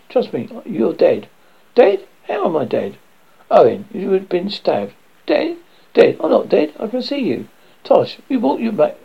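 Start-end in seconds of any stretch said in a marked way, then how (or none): 1.7-3.0 loud, much higher, shocked question; can't believe he's dead; shocked disbelief. Acted. Dead man learns he died. 5.3-7.5 higher, rising panic; clinging to being alive; panicked denial. Acted. Dead man denies being dead.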